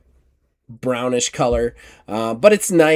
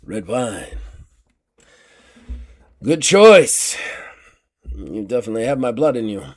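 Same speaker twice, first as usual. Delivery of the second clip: lightly voiced uncle, musing then approving; a hint of the old swordsman, punching the approval; uncle's voice. Uncle approving his relative's wine choice, lightly voiced character. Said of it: "He turns, he goes".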